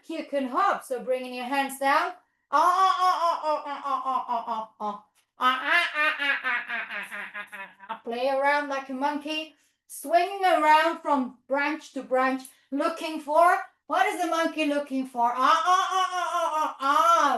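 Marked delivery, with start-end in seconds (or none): 2.5-8.0 quick ape chatter falling away; 'ah ah ah' hoots, loud then dying down; monkey hooting. Pretend. Teacher plays around like a monkey, hooting. Playing monkeys in kids' yoga. 15.3-17.4 high, loud ape chatter; monkey hoots at finding food; excited monkey hooting. Pretend. Monkey finds a banana, hooting excitedly.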